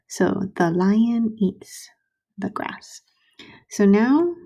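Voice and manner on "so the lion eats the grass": slow whisper. Shown as a demonstration. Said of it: "slow whispered reread".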